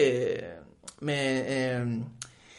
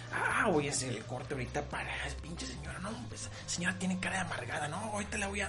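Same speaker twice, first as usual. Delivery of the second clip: much softer, fast, under the breath; spiteful muttering; 6 semitones lower, 8 dB quieter, faster than usual. Retold or imitated. Imagined cashier mutters spitefully about the customer, soft and fast.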